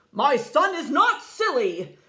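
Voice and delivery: loud, rising threat; growing fury, building to the last word; angry dragon father. A narrator's reading. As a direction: dragon father defends his son with a threat.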